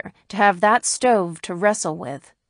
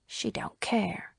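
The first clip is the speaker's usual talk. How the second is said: hushed whisper to herself; 10 dB quieter, slower than usual. A narrator's reading. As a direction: breathe it under your breath. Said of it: "she said to herself".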